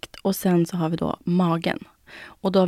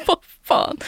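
mock indignant outrage; 11 semitones higher, 3 dB louder than usual. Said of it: "Jag bara".

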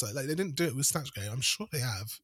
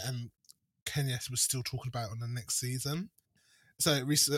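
usual speaking voice

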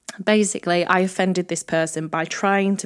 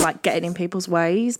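ordinary delivery

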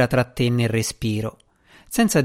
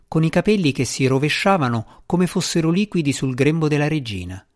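plain reading voice

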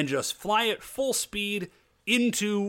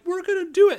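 put-on much higher and louder, a kid's eager cry; childish excited shout; 14 semitones higher, 6 dB louder, faster than usual. The Rangers charge the ship, shouting like excited children. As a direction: yell it like kids running to play.

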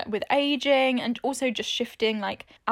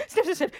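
quick sniffs; sniffing the air; 7 semitones higher, faster than usual. Pretend. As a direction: sniff like a dog. Acting a dog sniffing.

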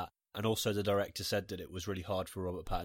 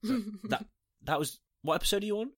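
surprised; 11 semitones higher than usual. Retold or imitated. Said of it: "And I was like".

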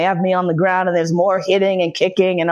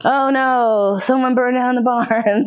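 higher-pitched, hammed-up alarm; mock dismay; 6 semitones higher, slower than usual. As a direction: feign shock at the news. Mock reaction inside retold roleplay.

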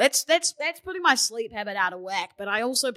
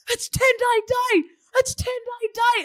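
excited yell; 13 semitones higher, 4 dB louder than usual. Retold or imitated. Strangers excitedly greeting in taxi.

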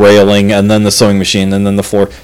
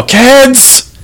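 retold yell; 12 semitones higher than usual. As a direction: imitate yelling for the kids, pitched way up. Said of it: "we just yell".

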